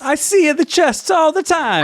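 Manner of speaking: high put-on Italian man voice; mock Italian captain. Imitating the boat captain who shrugs at nudity, high hammed-up voice. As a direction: ham up the carefree captain.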